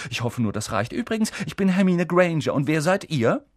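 high, eager, rapid-fire chatter; breakneck gushing. A narrator's reading. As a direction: rattle it all off without stopping. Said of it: "Das alles sprudelte in atemberaubender Geschwindigkeit".

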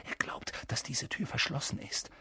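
hushed, voiceless secrecy; whisper. A narrator's reading. Harry hiding from Filch behind a door, hushed whisper. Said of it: "flüsterte Harry".

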